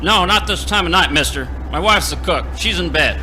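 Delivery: loud, irritated shout through the door; annoyed yell. Acted. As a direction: bark it at the late visitor. Owner refusing late customer.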